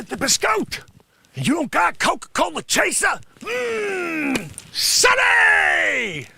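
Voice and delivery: high, loud yelling; loud comic yelling at Sonny; comic shout. Acted. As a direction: yell across the shop. Shouting character at counter.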